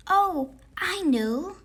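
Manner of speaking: a hint of a chirpy little monster; chirped. A narrator's reading. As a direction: pipe up like a keen pupil. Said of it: "chirped the red monster".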